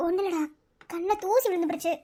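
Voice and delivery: soft, low, tearful excuse; hiding tears. Acted. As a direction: brush off the crying quietly. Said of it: "ஏன் அழுகுற".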